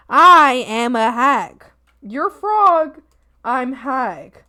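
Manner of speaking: ordinary talk, nothing marked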